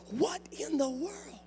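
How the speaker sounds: whispered dad's saying; hushed imitation of a father's saying. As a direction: breathe it out like dad did. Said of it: "one of them was".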